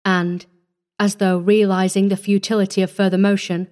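usual speaking voice

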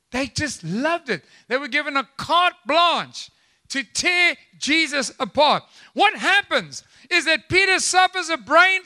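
high-pitched, driving sermon delivery; impassioned preaching. Real, not acted. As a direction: punch key words to the congregation. Preaches at full force.

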